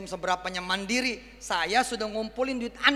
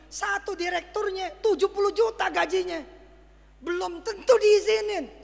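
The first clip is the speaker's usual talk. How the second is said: rising exasperation; 10 semitones higher, 4 dB louder than usual. Real, not acted. Preacher complains to congregation about director salaries, rising exasperation. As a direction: pitch climbs high into an exasperated cry. Exasperated retelling of licensing costs.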